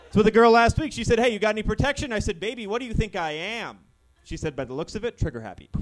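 hammed-up club-comic patter, starting high and falling; gun doing stand-up. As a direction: tell one-liners as a gun doing stand-up. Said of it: "my impression of a gun doing stand-up comedy".

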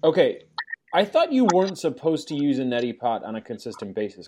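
ordinary talk

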